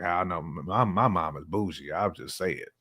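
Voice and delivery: ordinary talk, nothing marked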